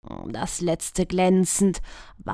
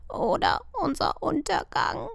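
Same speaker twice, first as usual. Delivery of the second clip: high, quiet magpie croak; soft croak; 9 semitones higher, 3 dB quieter than usual. A narrator's reading. Magpie Vika croaking a gloomy reply. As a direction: croak it darkly. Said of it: "Vika krächzte leise".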